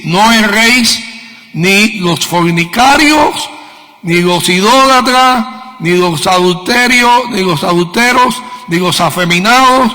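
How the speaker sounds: loud, slow, forceful scripture reading; preacher thundering a list of sins; preaching scripture. Acted. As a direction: proclaim each sin like a warning.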